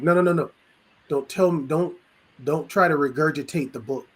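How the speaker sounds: normal talking voice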